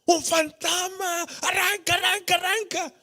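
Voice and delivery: scared disciples crying out to flee; mock-terrified yelp, pitched high; panicked cry. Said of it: "se pusieron a gritar".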